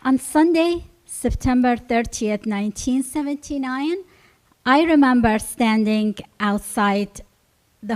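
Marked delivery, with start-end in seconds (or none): none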